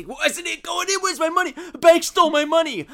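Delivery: high, loud whiny shriek; mock whiny customer. Mocking an entitled customer, whiny shriek. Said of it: "You say".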